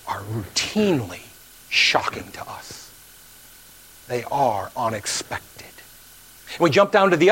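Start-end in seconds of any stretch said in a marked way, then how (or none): 0.1-5.6 hushed awe. Real, not acted. Hushed point about God's salvation.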